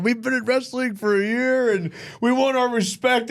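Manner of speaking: high, slowing, earnest-sounding promo imitation; re-enacted wrestling promo. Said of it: "I was like, uh".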